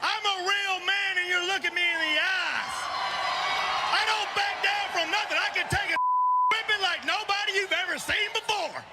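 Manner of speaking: fierce, high shouted challenge; angry shouting. Acted. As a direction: bark it like a furious brawler. Angry wrestling promo callout.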